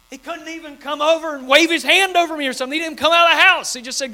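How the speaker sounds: high, loud indignant rant; mock outraged Naaman rant; mad Naaman. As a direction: complain like an offended general. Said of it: "Naaman was mad".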